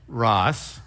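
"Ross" said alone as the second syllable, then a stop; a single clear syllable "Ross". Shown as a demonstration. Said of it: "syllable by syllable".

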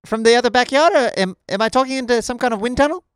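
high, loud Kiwi accent; hammed-up New Zealand accent, questions climbing. Shown as a demonstration. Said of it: "your best New Zealand accent".

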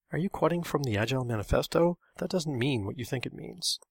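usual speaking voice

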